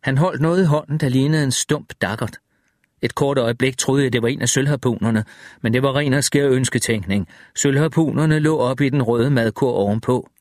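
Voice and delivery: ordinary delivery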